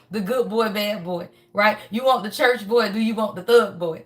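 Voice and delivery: ordinary delivery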